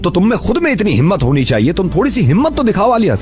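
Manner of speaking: ordinary talk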